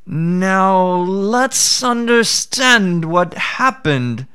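a crafty jackal pretending to be slow-witted; sly jackal. Jackal voiced in folk tale.

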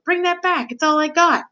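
high-pitched prisoner's plea; shouted plea. A narrator's reading. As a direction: cry out for your only possession. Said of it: "shouted the prisoner".

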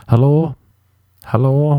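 high mock call through a door. Comedian mocking knocking on every restroom, calling out.

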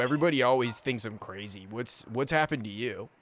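ordinary delivery

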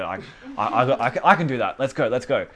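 mock hype, rising to a loud push; hyped-up bravado. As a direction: psych yourself up to go evangelize. Hyped-up believer voiced in story.

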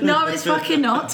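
usual speaking voice

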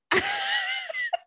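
squealing high laugh; high giggle. Real, not acted. Laughing at the cat's name.

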